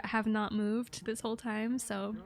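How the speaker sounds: normal talking voice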